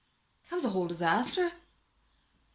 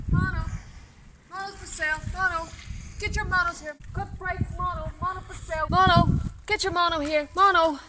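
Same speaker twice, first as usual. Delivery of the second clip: high-pitched hawker's calls; street vendor's cry; 8 semitones higher than usual. Acted. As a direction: hawk your wares up high, in short repeated cries. Acts out hawking on the street.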